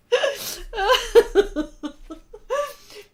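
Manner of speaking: high, loud helpless laughter; long uncontrollable giggle fit; laughing fit. Real, not acted. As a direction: laugh until nearly crying. Laughing fit over the accent.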